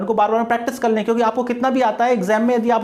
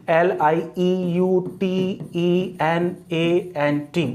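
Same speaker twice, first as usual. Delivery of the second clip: very slow, separated letters; measured classroom spelling; spelled out slowly; much slower than usual. Shown as a demonstration. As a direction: dictate it one letter at a time. Spelling out word for students.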